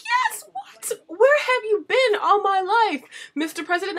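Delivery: high, loud, slow starstruck squeal; swooning fan. Retold or imitated. Fan swooning at the president, high gush. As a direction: swoon over him like a lovestruck fan. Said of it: "I'm like".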